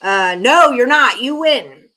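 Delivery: loud teasing protest; loud playful. Real, not acted. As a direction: playfully shout them down. Reacting to chat comment.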